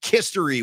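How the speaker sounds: history punned with a K in front; history as "KISS-tory". Shown as a demonstration. Host asking about the band, history said as "KISS-tory". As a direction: swap the H of history for a K. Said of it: "or should I say".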